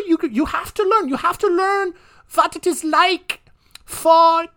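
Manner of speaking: high, hammed-up German father voice; mock German accent. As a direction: play the abusive German dad, way up high and thick-accented. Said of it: "German accent, though, very strong".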